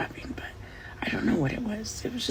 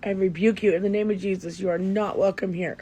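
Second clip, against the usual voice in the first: firm, louder rebuke; stern rebuke; 2 semitones lower, 7 dB louder than usual. Real, not acted. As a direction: command the spirit to leave. Rebuking a spirit in Jesus' name.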